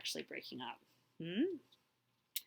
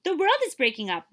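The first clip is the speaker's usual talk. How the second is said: panicked warning; 7 semitones higher, 5 dB louder than usual. Acted. Deer warn the tiger.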